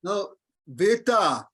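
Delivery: "veta" with a long open a at the end. Shown as a demonstration. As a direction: stretch the final a of "veta". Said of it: "the veta is long R".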